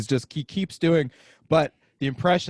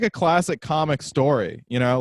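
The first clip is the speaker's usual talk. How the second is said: usual speaking voice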